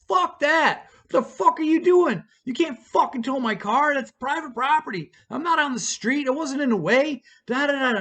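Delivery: high, indignant protest; re-enacted pissed-off rant; laying into someone. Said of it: "I lay into Dylan about towing my car".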